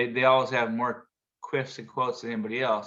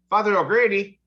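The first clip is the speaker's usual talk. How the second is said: high, louder plea to priest; grieving widow calling out, higher and louder; 7 semitones higher, 7 dB louder than usual. Retold or imitated. Grieving widow calling out to the priest.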